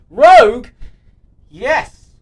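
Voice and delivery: high, loud burst of excitement; sudden excited shout. Real, not acted. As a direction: yelp it out in delight. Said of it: "you got me very excited by Rogue".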